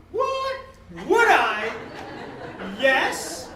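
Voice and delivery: loud, hammed-up amazed shopper; mock amazement, loud and punchy; thrilled disbelief. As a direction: play it bowled over by the offer. Acted shopper thrilled by offer.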